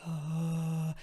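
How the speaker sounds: ordinary talk, nothing marked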